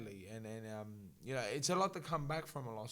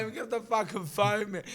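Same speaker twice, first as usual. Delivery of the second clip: mock yell; 8 semitones higher, 6 dB louder than usual. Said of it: "I'm like".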